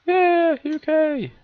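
high, loud proud cheer; excited cheer. Real, not acted. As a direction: cheer for home. Cheers for his home country.

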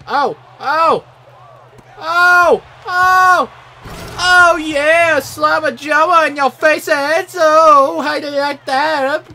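high, loud gloating cheer; triumphant shouting. Real, not acted. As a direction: crow over the basket at full voice. Celebrating a score in game.

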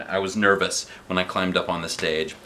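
ordinary delivery, nothing marked

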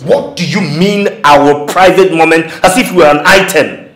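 loud, offended challenge; indignant. Acted. Rejecting talk of a private moment, loudly indignant.

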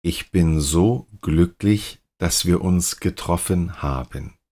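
ordinary delivery

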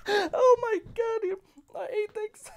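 high shrieking laughter and wails; laugh-cry in despair; hysterical laugh-cry. Real, not acted. Laughing crying at loss.

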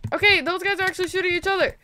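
ordinary talk

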